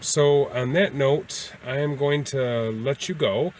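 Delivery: ordinary talk, nothing marked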